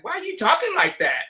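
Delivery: put-on girlfriend quoted. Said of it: "my girl was like".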